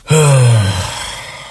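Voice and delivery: long, heavy real sigh; big drawn-out sigh. Real, not acted. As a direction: let out one long audible breath. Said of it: "that was a real sigh".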